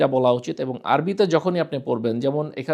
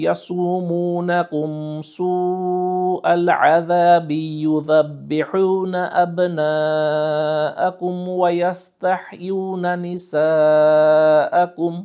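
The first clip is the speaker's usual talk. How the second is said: slow, melodic Arabic recitation; Quran recitation; 4 semitones higher, slower than usual. Shown as a demonstration. Teacher reciting a verse for students, slow melodic recitation. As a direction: chant the verse slowly in tajweed.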